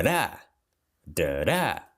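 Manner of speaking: "Da, da" as weak "duh", strong rising-falling "DA"; second beat stronger. Shown as a demonstration. Teacher tapping out the stress, "Da, da" stronger on the second. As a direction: hit the second "da" harder. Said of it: "Mais forte na próxima".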